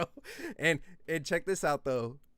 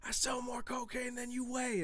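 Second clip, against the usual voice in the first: retold scream; 11 semitones higher, 5 dB quieter than usual. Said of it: "I was screaming that shit on the microphone".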